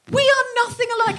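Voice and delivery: exasperated retort. Retold or imitated. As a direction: blurt out the exasperation. Friend retorting mistaken identity.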